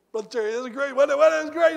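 high, loud gushing pastor; excited pastor. Retold or imitated. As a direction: gush like an overexcited young pastor. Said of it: "he's all excited".

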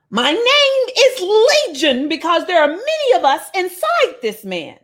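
a lightly put-on demon voice; spirit voice. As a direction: say it as the eerie many spirits would sound. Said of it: "Y'all laughing at my voice".

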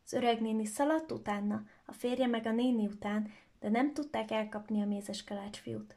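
ordinary delivery

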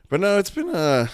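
squeaky crack upward. Real, not acted. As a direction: voice breaks into a squeak. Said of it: "there goes my squeak".

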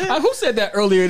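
high laughing question. Real, not acted. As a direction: laugh through the words. Laughs at recalled joke.

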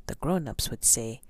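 usual speaking voice

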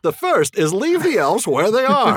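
high, loud, drawn-out gloating; mock-villain parody. Joining cohost's joke about blunders, high loud gloating. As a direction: crow it loudly, stretching the words. Joins in the movie-line parody.

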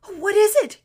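startled frightened gasp, high and loud. A narrator's reading. Boy hearing a lion's roar in the dark, gasping. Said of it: "gasped Shasta".

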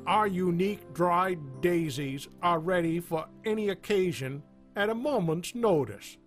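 upbeat radio commercial voice; ad read. Acted. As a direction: sell it like a cheesy radio ad. Sponsor ad read over music.

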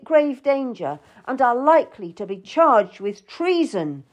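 high, emphatic scolding; angry. A narrator's reading. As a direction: reproach her angrily, hammering each charge. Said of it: "he looked at me angrily".

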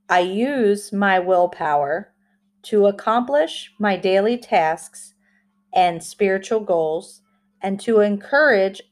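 slow, deliberate affirmation reading. Shown as a demonstration. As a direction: read each phrase slowly with pauses. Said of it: "I will say it slowly like I always do".

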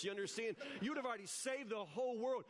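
normal talking voice

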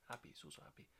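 breathy near-whisper; whispered Pep. Acted. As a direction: hush it out, wide-eyed.

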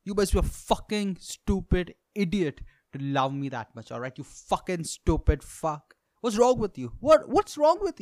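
furious rant at someone who loves him; angry yelling. Retold or imitated. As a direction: yell it, building to a scream. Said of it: "I just go".

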